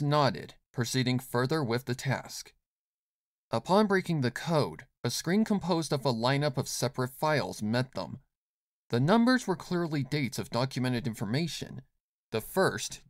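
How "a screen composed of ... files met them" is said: plain reading voice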